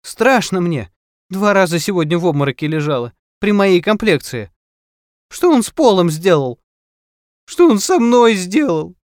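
high, loud, fearful lament building to a wail; upset woman close to breaking down; distraught mother. A narrator's reading. Frightened mother complains, then bursts into sobs.

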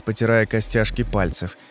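ordinary delivery, nothing marked

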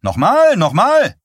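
high, loud urging cries; shrill, insistent cheer; shouting. A narrator's reading. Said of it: "rief Eileen".

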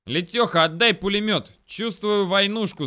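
loud, high, eager yell; excited squealing. A narrator's reading. Soldier begging lieutenant for machine gun, squealing. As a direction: squeal with glee for the fight. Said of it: "завизжал в голос Лёня".

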